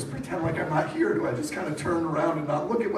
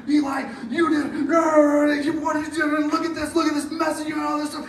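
higher, louder mock manager yell; 7 semitones higher, 4 dB louder than usual. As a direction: bark at him like a manager on the attack. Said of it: "starts yelling at you".

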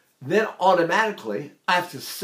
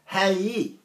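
Texas accent; 3 semitones higher than usual. Shown as a demonstration. Texan explaining how folks back home greet, Texas accent. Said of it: "We say".

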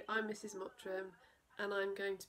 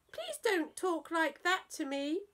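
higher, mocking child's retort; cheeky mimicking child; 9 semitones higher, 6 dB louder, slower than usual. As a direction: throw the words back at the parents. Mabel answers back rudely.

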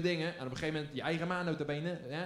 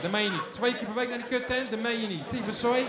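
verontwaardigd; 6 semitones higher, 5 dB louder than usual. Retold or imitated. Outraged at twice a week.

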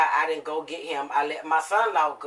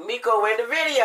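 pitched-up exclamation; retold dismayed discovery; 5 semitones higher than usual. Retelling spotting Miko in the posted video, mock dismay. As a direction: blurt it like a sudden realization.

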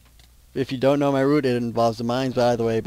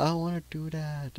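put-on whiny kid voice; 5 semitones higher, 7 dB quieter than usual. Voicing himself pleading with parents.

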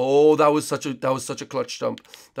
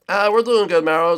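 put-on a louder, higher kid villain answering Mario; Bowser Junior voice; 3 semitones higher, 8 dB louder than usual. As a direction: pipe up as the cocky little Koopa prince.

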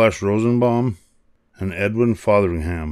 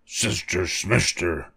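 slow, softer monster growl; 2 semitones lower, 7 dB quieter than usual. A narrator's reading. Grimy monster dismissing Lucy, slow mild growl. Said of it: "growled a grimy monster".

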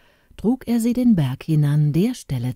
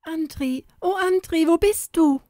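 anxious longing call; 12 semitones higher than usual. A narrator's reading. Inga calling anxiously for her beloved Andri. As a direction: call for him, afraid and yearning. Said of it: "kam es jetzt in Angst, Liebe und Sehnsucht".

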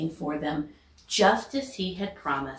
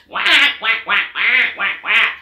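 loud quacking; 3 semitones higher, 10 dB louder than usual. Acted. Missus Mallard quacking at the cars, loud quacks. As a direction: quack like a startled mother duck. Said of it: "went Missus Mallard".

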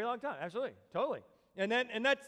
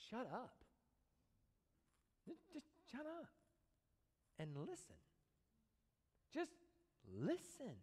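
hushed; 4 semitones higher, 13 dB quieter than usual. Real, not acted. Telling church to stop and listen.

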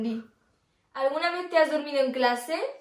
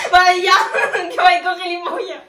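laughing; 5 semitones higher, 9 dB louder than usual. Real, not acted. Reacción riendo.

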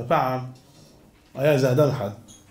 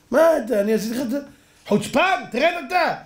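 indignant quarrel; 6 semitones higher than usual. Acted. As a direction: bristle at the thief's nerve. Owner and cripple quarrel.

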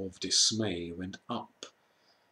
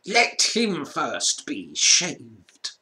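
slow, higher gloating witch voice; witch's cruel order; 4 semitones higher, 5 dB louder, much slower than usual. A narrator's reading. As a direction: relish the humiliation. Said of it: "said the witch".

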